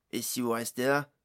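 loud, put-on character voice; Jason's sudden suggestion. A narrator's reading. Jason suddenly addressing his companions, calling out an idea. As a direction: throw the idea out loudly. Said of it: "lança soudain Jason".

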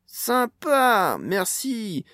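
high, loud delighted exclamation; smiling thanks. A narrator's reading. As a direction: cheer a gleeful thank-you. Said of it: "dit le gnome en lui souriant".

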